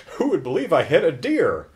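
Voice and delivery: ordinary talk, nothing marked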